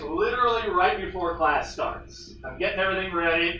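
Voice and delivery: ordinary talk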